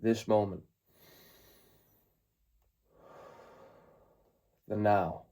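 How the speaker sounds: calm meditation guide, long pauses between phrases; guided meditation. Acted. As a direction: ease them into the present moment, unhurried.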